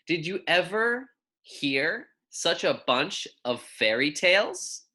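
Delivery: put-on clear, measured picture-book reading for young kids; reading to children. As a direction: read it gently to second graders, savouring each pun. Reads picture book aloud.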